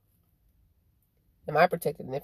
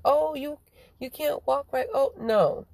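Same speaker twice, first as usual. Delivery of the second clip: mocking quote; 8 semitones higher than usual. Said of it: "They be like".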